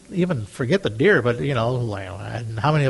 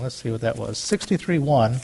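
ordinary talk, nothing marked

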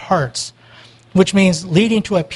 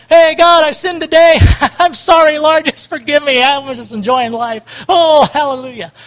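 high, loud, breezy mock apology with a laugh; flippant prayer; 5 semitones higher, 4 dB louder than usual. Preacher mocks a carefree sinner's prayer, high and flippant. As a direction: toss off a careless sorry to God. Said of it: "tripping down the thing and going".

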